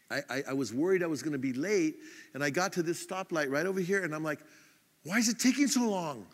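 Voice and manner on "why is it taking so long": higher, impatient complaint; irritated. Retold or imitated. Late driver at a stoplight this morning, irritated. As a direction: grumble at the delay, mildly exasperated. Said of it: "I was getting all irritated".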